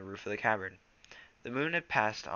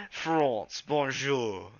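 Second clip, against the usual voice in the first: hammed-up French accent, a bit higher; 4 semitones higher, 5 dB louder, much slower than usual. Shown as a demonstration. Reader showing River Clan's French accent, hammed-up French. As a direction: toss off a mock French bonjour. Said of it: "they're gonna be very France".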